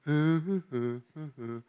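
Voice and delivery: low, soft wordless grumbling; reluctant grumble. Acted. Preacher mimicking someone told to do something, reluctant grumbling. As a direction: imitate a grudging mumble of protest.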